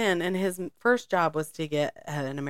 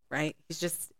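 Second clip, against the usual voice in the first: ordinary talk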